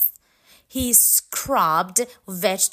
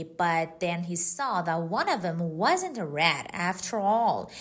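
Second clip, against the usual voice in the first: plain reading voice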